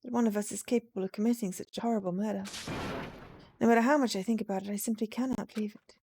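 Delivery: ordinary talk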